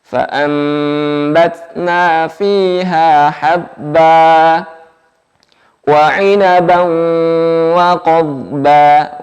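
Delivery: slow, chanted Quranic recitation. Shown as a demonstration. Teacher models the verse for students, slow chant. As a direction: recite it slowly, each letter placed.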